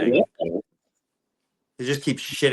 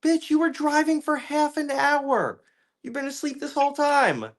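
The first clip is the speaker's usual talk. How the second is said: mock sassy retort. To the crying husband after the crash, high sassy mockery.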